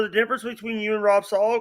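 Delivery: usual speaking voice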